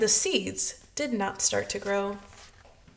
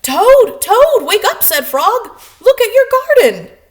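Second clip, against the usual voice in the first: calling loudly; 10 semitones higher, 15 dB louder than usual. Acted. Frog waking sleeping Toad.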